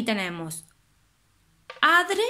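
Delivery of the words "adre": "adre" alto y marcado; énfasis en la rima. Shown as a demonstration. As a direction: subraya "adre" como terminación. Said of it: "no hay ninguno que rime con adre". (profesora destaca "adre" para la rima)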